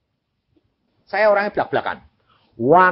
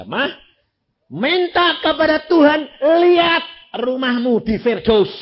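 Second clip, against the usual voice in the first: loud urging; 9 semitones higher, 5 dB louder than usual. Retold or imitated. Retold urging of mother, preached loud.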